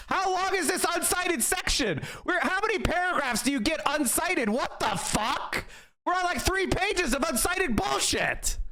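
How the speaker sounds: stretched, outraged complaining; incredulous rant. Real, not acted. Complaining about uncited pages, high incredulous outrage. Ranting at the long uncited section.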